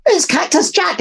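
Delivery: very high, alarmed little voice; high lizard cry. A narrator's reading. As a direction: blurt out the culprit's name. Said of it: "Liz cried".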